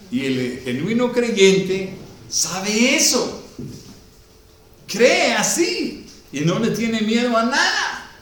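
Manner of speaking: loud, high, slow sermon climax; full-force preaching. Acted. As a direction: preach it out to the whole church.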